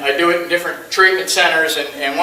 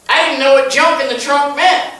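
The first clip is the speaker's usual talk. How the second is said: usual speaking voice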